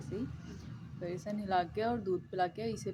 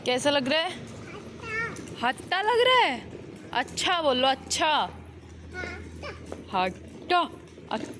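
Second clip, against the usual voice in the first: high sing-song encouragement; 6 semitones higher than usual. Real, not acted. Talking to toddler on ride.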